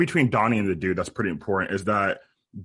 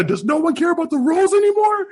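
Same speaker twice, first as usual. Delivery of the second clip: climbing, exasperated movie-quote shout; mock outraged yell; 4 dB louder than usual. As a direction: voice shooting way up high. Acted Walter yell from meme.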